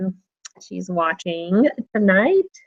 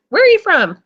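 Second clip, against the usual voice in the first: mock question; 8 semitones higher, 5 dB louder than usual.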